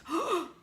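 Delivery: a quick, high startled gasp; sharp gasp. Acted. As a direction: suck in air in shock. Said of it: "Roy gasped".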